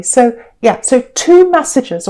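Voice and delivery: usual speaking voice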